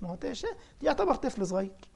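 ordinary talk

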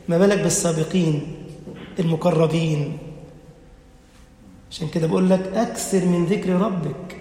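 ordinary delivery

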